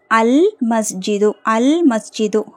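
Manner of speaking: slow "அல் மஸ்ஜிது" with held L; teacher's slow, clear "அல் மஸ்ஜிது", L sounded; lam pronounced. Shown as a demonstration. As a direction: hold the L in "அல் மஸ்ஜிது" for learners. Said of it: "லாம் உச்சரிக்கப்படணும்".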